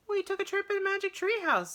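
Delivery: put-on high, quick little girl voice; just state it like it's obvious; simply, matter-of-fact. A narrator's reading. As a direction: Annie explaining simply. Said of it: "said Annie simply".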